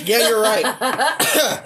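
slow laughing through a burn; wheezy laugh after the shot. Real, not acted.

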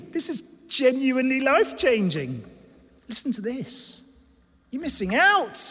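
high-pitched, urgent interruption; Paul. Acted. Preacher as Paul interrupting Athens with news, urgent and excited. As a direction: stop them with eager good news. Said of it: "but Paul's like".

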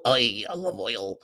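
slow, drawn-out cartoon sailor call; Popeye voice. To his sweetheart, a slow cartoon sailor call.

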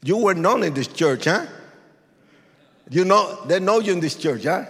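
ordinary talk, nothing marked

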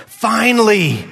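high, eager "Finally," with a falling sigh; joyful Adam. Retold or imitated. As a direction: let "Finally," rise high in relief. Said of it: "Adam says".

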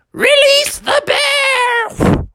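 slow, very high owl cry; drawn-out falsetto battle cry; commanding owl. A narrator's reading. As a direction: announce the launch like a wild bird.

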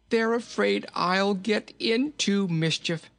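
high, drawn-out merry voice dissolving into laughter; tickled by his own plight; jolly giggling king. A narrator's reading. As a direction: giggle helplessly between phrases.

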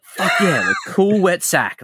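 lightly voiced, pleased cartoon pupil; Cletus. Cletus noting down a parenting tip, mock enthusiasm. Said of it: "Cletus, like, writes it down".

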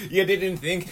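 ordinary talk, nothing marked

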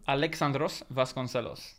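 Alexandros Vasconcelos with rolled R's and Portuguese vowels. Shown as a demonstration. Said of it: "go for that one more time".

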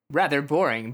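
ordinary talk, nothing marked

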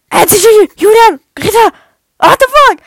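high, very loud disbelieving shouts; shocked outburst. Real, not acted. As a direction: shout each name in shock, building up.

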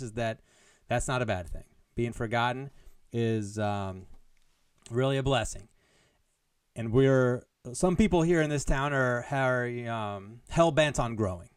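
ordinary talk

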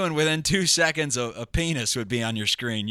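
ordinary talk

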